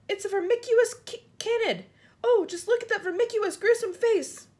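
high, loud, aghast exclamations; horrified cry. A narrator's reading. Police chief staring at the spider's face, crying out. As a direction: cry out in mounting horror. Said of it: "cried the chief of police".

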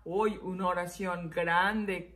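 ordinary delivery

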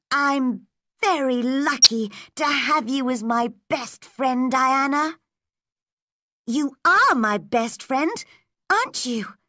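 put-on high-pitched, fond little girl voice; a girl earnestly treasuring her best friend; Anne, affectionate little girl.

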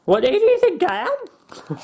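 high, slow mock outrage; mock offended. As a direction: act insulted in a squeaky voice.

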